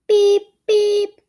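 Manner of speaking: high loud electronic beep; watch beeping. A narrator's reading.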